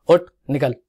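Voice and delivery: curt, rude order to leave; insulting dismissal. Shown as a demonstration. Throwing someone out with no respect, mildly insulting. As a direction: dismiss them with mild contempt. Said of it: "सीधे बेइज्जती कर दी".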